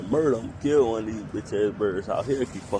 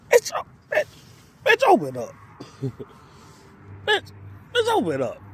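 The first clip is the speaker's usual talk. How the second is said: frustrated yell. Real, not acted. Struggling to open a bag.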